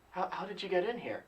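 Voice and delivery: very soft, probing question; leaning in. Retold or imitated. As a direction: quietly press for an answer. Retold question repeated, leaning in.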